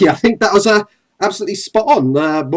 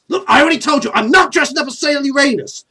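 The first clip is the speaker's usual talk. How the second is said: loud, raised-pitch protest; indignant refusal; 9 semitones higher, 6 dB louder, faster than usual. Acted. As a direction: put your foot down, exasperated. Acted indignant rival Kaiba refuses.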